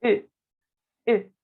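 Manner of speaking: French E with lips rounded as for O; short rounded French "E". Shown as a demonstration. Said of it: "la pronunciación de la E".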